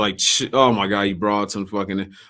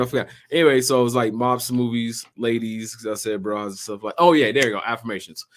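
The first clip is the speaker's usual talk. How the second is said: usual speaking voice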